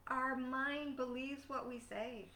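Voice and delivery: ordinary talk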